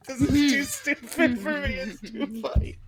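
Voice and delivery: words squeezed out high and slow through laughter; can barely talk for laughing. Real, not acted.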